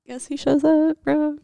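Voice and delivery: high, playful guess-who voice; teasing high reveal. Real, not acted. Storyteller sets up reveal.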